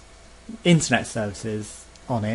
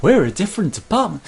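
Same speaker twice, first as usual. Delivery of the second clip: mock agent voice; 9 semitones higher, 8 dB louder than usual. Mocking agent.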